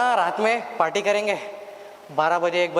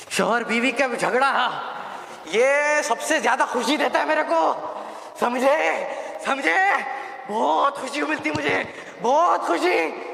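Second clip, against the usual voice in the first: higher, laughing gloat over the quarrel; gleeful laughing; 6 semitones higher than usual. Acted. Devil savoring a husband and wife fighting, gleeful laughter. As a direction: chuckle with wicked delight.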